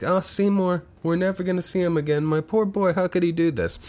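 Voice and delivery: high, wailing mock sob of an old mother; crying old mother. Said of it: "she's crying, and she's like".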